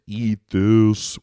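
booming alien order; alien command. Pretend. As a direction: bark it like an alien overlord. Aliens commanding with their mind.

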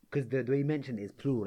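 ordinary talk, nothing marked

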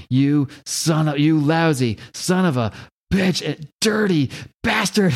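slow, punched-out curses, rising in pitch; mock angry cursing. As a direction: curse between blows, hammed-up. Acts Kevin cursing between blows.

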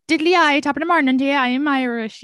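hammed-up high, loud Irish brogue; stage Irish. Comedian mocking a clichéd Irish stage act, hammed-up Irish brogue. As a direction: lay the Irish on thick like a cheesy act. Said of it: "if I got up there and I was like".

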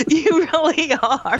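words through real laughter; laughing. Real, not acted. Co-host teasing host while laughing.

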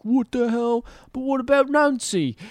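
high-pitched put-on voice, indignant; mock protest. Band member objecting to the producer's new tour rule, high mock protest. Acting out Sid's shocked protest.

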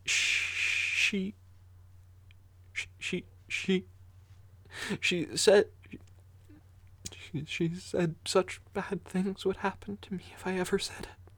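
put-on high, hushed, stammering child's whisper; too scared to get it out; frightened stammer. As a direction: Fifth stammers in terror.

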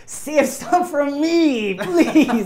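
high, slow, loud begging; eager pleading. Acted. GM voices Demeter begging for a share, eager plea. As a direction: plead like a little guy left out. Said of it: "Demeter's like".

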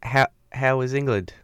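put-on loud, faltering stab at a British accent landing in New Zealand; botched New Zealand accent. Co-host asks traveler about England, botched New Zealand accent. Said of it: "I'm doing, like, a fucking New Zealand accent".

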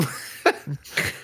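breathy laugh at his own joke; a short airy laugh. Real, not acted.

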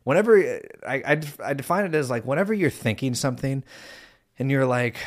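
ordinary talk, nothing marked

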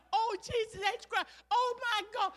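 high-pitched gasping-fan exclamations; starstruck awe. Acted. Local coming out to meet Muhammad Ali, high starstruck awe.

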